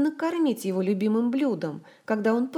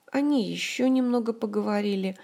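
usual speaking voice

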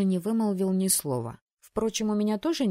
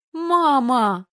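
high, loud protest; horrified daughter protesting; indignant cry; 7 semitones higher, 9 dB louder than usual. Acted. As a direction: wail at mother.

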